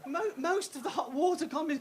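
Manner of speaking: normal talking voice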